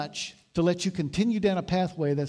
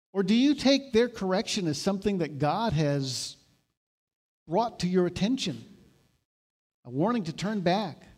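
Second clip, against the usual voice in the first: ordinary delivery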